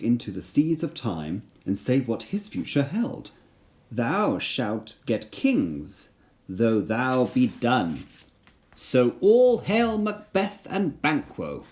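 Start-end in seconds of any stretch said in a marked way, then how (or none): 3.9-11.6 witches' prophecy. Acted. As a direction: chant the prophecy like an uncanny crone. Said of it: "said the witches".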